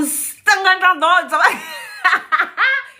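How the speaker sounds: high laugh with a yelp in it; shrieking laugh. Real, not acted. Laughing at her own harsh coaching words, shrieking.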